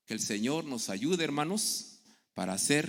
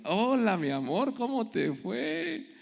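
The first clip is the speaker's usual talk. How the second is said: high, louder sweet welcome, slowing at the end; sweet greeting; 10 semitones higher, 3 dB louder, slower than usual. Pretend. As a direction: greet husband lovingly at the door. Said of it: "Quiere uno que reciban".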